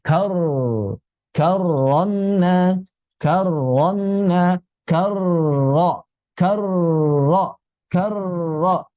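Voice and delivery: 'karonna' slow, double r vibrated close to the palate; correct ro. Shown as a demonstration. Teacher models for students, controlled ro. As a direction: keep the tongue tip up through 'karro'. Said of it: "tetap berada di dekat langit-langit".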